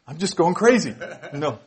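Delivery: loud, laughing through the words. Real, not acted. Preacher laughing at the crowd's joke, loud laughing.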